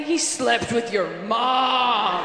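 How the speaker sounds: loud, very slow shouted verdict; booming, stretched-out comic climax; over-the-top accusation. Acted. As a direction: drag out the last word in mock outrage. Climax of mock cheating-article verdicts.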